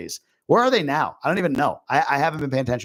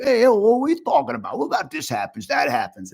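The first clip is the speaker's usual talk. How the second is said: brash New York Long Island uncle voice, pitched up; New York accent; 9 semitones higher than usual. Retold or imitated. Vlogger imitating her Long Island uncle in a New York accent. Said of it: "I use my New York accent".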